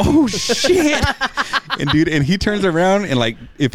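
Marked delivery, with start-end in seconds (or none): 0.0-1.0 high-pitched mock shock, half laughing; retold alarm. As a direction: blurt it way up high, reenacting the panic. Retells seeing ball hit face.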